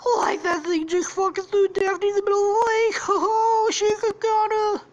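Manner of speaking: very high, loud cartoon voice; hammed-up high-pitched alarm; panicked Shaggy. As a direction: squawk it like a frightened cartoon slacker. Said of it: "Scooby and Shaggy see it".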